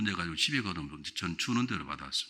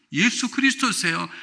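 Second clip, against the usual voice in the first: proclaimed loud; 11 semitones higher, 10 dB louder, slower than usual. Acted. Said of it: "누구예요?".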